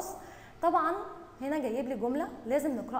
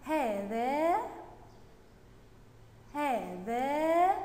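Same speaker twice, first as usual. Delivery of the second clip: "هذا" with long, stretched vowels; slow read-along; much slower than usual. Shown as a demonstration. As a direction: draw out each "هذا" for pupils to follow. Teacher leads pupils reading together. (قراءة بطيئة ممدودة)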